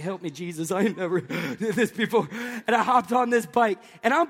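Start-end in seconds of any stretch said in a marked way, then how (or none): 0.0-2.3 whisper-prayer of a nervous rider; nervous inner prayer. Retold or imitated. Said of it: "I'm like".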